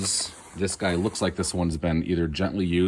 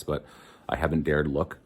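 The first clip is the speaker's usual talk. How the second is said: ordinary talk, nothing marked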